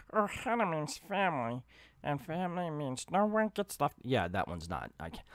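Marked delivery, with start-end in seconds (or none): 0.2-4.0 cartoon alien creature voice, pitched up, soft; Stitch. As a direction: attempt a cute little monster impression. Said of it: "that's, that's Stitch".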